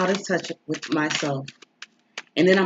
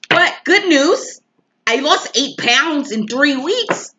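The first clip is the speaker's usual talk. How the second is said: much higher, louder and slow, bursting with joy; excited announcement; 9 semitones higher, 5 dB louder, slower than usual. Real, not acted. Telling viewers about her weight loss, thrilled and high. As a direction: sing out the good news.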